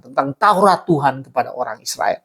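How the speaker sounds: ordinary delivery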